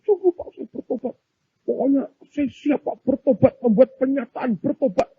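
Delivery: high-pitched begging for release; mock pleading cry. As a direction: plead urgently in a high voice, imitating a desperate prisoner. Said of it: "dia teriak-teriak begitu". (pura-pura memohon dengan suara tinggi)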